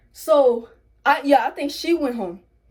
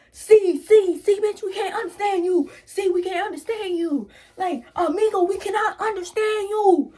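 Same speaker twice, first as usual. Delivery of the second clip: retold yelling friend; 7 semitones higher than usual. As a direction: bark it at her like he's fed up. Voicing friend yelling at mom.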